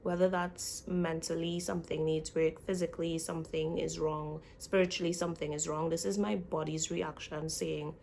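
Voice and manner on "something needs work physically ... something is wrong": normal talking voice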